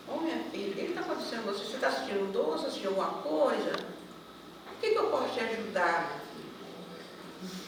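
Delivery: soft, tender questioning; gentle concern. Retold or imitated. Helper to a crying pregnant psychologist, gently concerned. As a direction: ask softly, as if consoling someone in tears.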